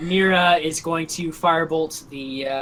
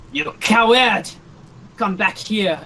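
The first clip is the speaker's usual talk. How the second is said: acted angry call, higher at first; angry taunt; 4 semitones higher than usual. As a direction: call back a fleeing coward. Acted villain shouts at fleeing enemy.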